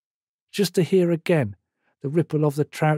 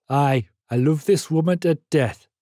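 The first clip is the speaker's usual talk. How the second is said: Geordie purr. A narrator's reading. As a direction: purr it fondly in a Geordie accent. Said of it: "purred the Geordie coal miner".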